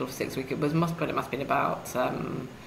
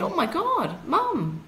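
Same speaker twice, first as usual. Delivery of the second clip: much higher, mock-shocked; shocked exclamation; 10 semitones higher, slower than usual. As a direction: retell your startled reply, higher. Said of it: "I said".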